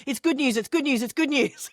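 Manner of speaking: high, quick good news burst; imitating an excited doctor; 1 excited repeat. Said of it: "she just went".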